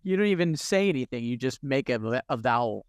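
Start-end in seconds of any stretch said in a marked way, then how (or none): none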